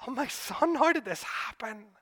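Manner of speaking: a mother stunned by joy, gasping it out; amazed mother. Pretend. Mother greeting her healed son, lightly voiced wonder. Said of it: "on his mother's face".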